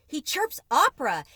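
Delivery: Papa hears opera from the cage, astonished and loud; amazed exclamation. A narrator's reading. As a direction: burst out in disbelief. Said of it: "exclaimed Papa".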